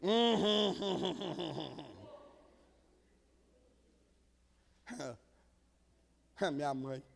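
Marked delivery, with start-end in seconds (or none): 0.0-5.2 hint at them with a knowing hum; pointed throat clearing. Retold or imitated. Preacher hums pointedly at the lax parents, fading out. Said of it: "eu falei".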